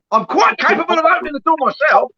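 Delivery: high, indignant woman's voice; mock offended woman. Retelling a woman refusing the door, high mocking voice. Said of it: "the woman has turned around and gone".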